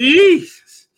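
high, loud Jesus; exasperated exclaim. Real, not acted. Still giggling at the joke, loud high exclamation. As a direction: blurt it out high. Recovering from laughter.

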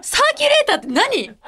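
high-pitched laughing puzzlement; baffled question. Real, not acted. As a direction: ask it like you've never heard the word. Baffled by theme word. (笑いながら困惑して問いかける)

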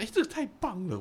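哎 thrown up high in delight; mother's daughter cheering at the park idea, excited. Retold or imitated. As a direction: cheer it like a great find.

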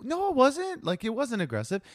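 high indignant denial; defensive protest. Retold or imitated. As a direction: insist you did nothing wrong. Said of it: "Kind of like".

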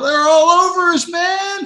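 high, loud panicked yelling; mock hysterical soldier, crying out as the threat closes in; Bill Paxton. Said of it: "put Bill Paxton in there going".

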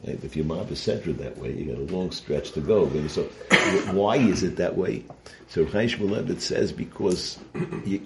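normal talking voice

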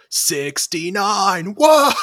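long high yell building to a whoop; shouted movie quote. Acted. Both Teds answering the number question, ecstatic drawn-out shout. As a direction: stretch it out loud, then explode upward. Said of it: "they both just go".

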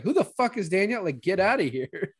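high-pitched, baffled dismissal; mock indignation. Viewers reacting to Danielle's interruption, high-pitched mock indignation. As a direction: exclaim it high in mock outrage.

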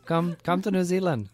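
high, loud put-on New Zealand accent; mock New Zealand accent. Joking as a Kiwi fan offering a spare room, hammed-up New Zealand accent. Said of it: "That's like Irish or".

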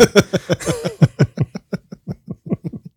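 high, drawn-out real laughter; real laughing fit, pitched high; long helpless laugh. Real, not acted. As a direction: crack up at your own story. Cracks up at own story.

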